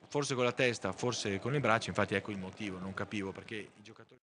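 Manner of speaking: ordinary talk, nothing marked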